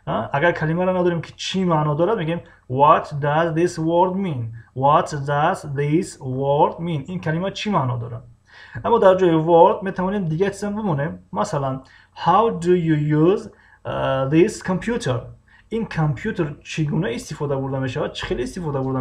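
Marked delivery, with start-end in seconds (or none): 2.7-7.0 very slow, word-by-word question; drawn-out language-lesson enunciation; teacher modelling. Shown as a demonstration. 12.1-15.2 slow, deliberate example question; drawn-out lesson enunciation; teacher modelling. Shown as a demonstration.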